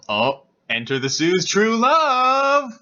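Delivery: high, loud, stretched announcement; mock fanfare. Real, not acted. Reader announcing the heroine's love interest, mock fanfare. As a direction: herald it grandly, drawing out the end.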